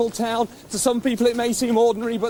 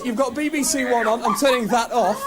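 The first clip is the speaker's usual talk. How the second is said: ordinary talk, nothing marked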